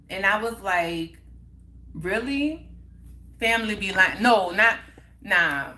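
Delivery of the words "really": higher, rising one-word question; retold disbelief, pitched up at the end; incredulous. Girlfriend answering his request for a break, incredulous. Reacting to being dumped.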